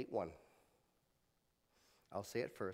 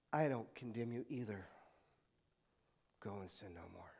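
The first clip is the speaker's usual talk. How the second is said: tender Jesus; 6 semitones lower, 8 dB quieter than usual. Acted. Jesus speaking tenderly to woman.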